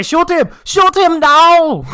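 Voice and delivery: high, loud mock Japanese accent; mock accent shouting. Co-host imitating gamers in battle, hammed-up accented yell. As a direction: cry out the order, last word stretched. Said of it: "Do they say".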